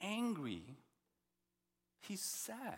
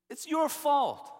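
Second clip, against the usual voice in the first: higher, louder, slow blaming cry; loud accusation; 7 semitones higher, 10 dB louder, slower than usual. Acted. Preacher voicing the psalmist accusing God. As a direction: hurl the blame at God.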